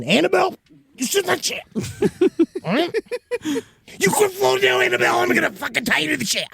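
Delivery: high, scolding mock parent voice. Telling the floating doll to sit down, high mock scolding. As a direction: scold the doll like an angry mom.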